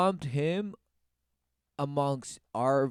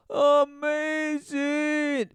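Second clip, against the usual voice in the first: sarcastic amazement; 9 semitones higher, much slower than usual. Real, not acted. Sarcastic reaction to news clip.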